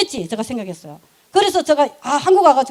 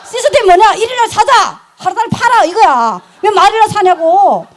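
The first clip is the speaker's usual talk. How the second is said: emphatic proclamation; 5 semitones higher, 4 dB louder than usual. Retold or imitated. Proclaiming her new system.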